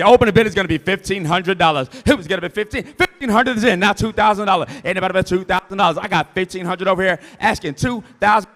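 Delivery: loud, rhythmic auctioneer calling out bids. Acted. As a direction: drive the bidding like an auctioneer.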